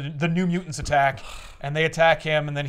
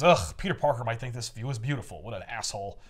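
grumbling; 6 semitones lower, 5 dB quieter than usual. Retold or imitated. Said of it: "And he goes".

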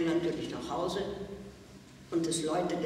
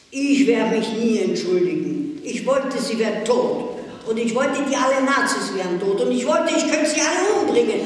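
sehr laut und höher, immer rasender; hasserfüllter Ausbruch; 4 semitones higher, 9 dB louder than usual. A narrator's reading. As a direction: tobe dich in blinder Wut aus. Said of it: "Vor lauter Hass". (Susi tobt vor der Direktorin voller Hass)

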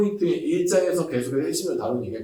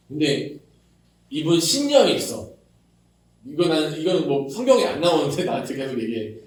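ordinary talk

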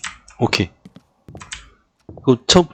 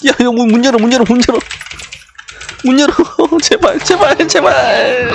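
high frantic shouting and pleading; panicked begging; 9 semitones higher than usual. Real, not acted. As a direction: beg in real panic. Begging to get the door open.

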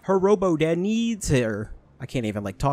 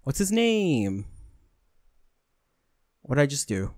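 ordinary talk, nothing marked

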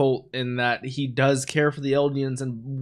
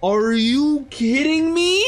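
high, slow, stretched disbelief; 10 semitones higher, 4 dB louder, slower than usual. Real, not acted. Reacting to the brothers' scene, in slow high disbelief. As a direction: drag out the shocked question.

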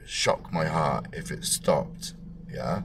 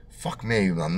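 ordinary delivery

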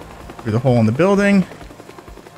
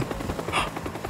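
panicked gasp; 14 dB quieter than usual. Real, not acted.

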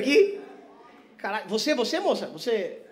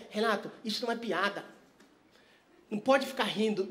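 quoted commenter; 3 semitones lower, 9 dB quieter than usual. Retold or imitated. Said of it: "eu postei um vídeo ... e falando".

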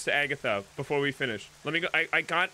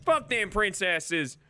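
put-on higher, slow country-accent grumble; 6 semitones higher, slower than usual. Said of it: "in this accent".

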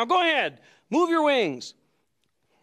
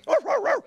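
high yappy pretend barks; dog barking; 14 semitones higher, faster than usual. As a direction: bark like a happy puppy. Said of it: "pretending to be a dog".

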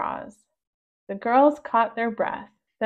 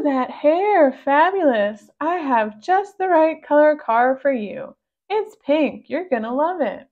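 louder, higher, gushing car salesman; salesman pug voice; 5 semitones higher, 5 dB louder than usual. Acted. Pug salesman greets the girls, gushing and loud. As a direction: flatter the girls like a slick seller.

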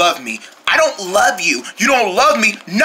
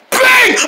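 loud high "Bang!" sound effect; punch sound; 5 dB louder than usual. Acted. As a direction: shout the punch noise. Punching the crate.

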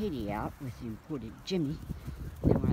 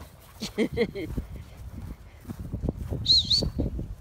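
unvoiced laugh rising in pitch; 9 semitones higher than usual. Real, not acted. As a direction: laugh at your own joke. Laughing at own joke.

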